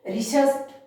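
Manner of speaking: ऋषष्ठ in one breath, ending on a hard ष्ठ; rishashth mnemonic. Shown as a demonstration. Teacher drills the class on the ऋषष्ठ mnemonic. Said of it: "हम उस भय को नहीं बोलेंगे".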